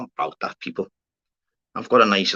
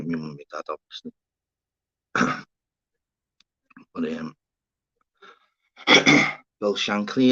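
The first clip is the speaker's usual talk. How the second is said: ordinary talk, nothing marked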